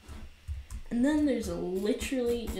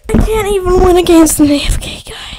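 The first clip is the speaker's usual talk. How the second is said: real high-pitched yell, very loud; loud, high gamer rage; frustrated outburst; 9 semitones higher, 22 dB louder than usual. Real, not acted. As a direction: explode at losing to an idle player. Losing to an idle opponent.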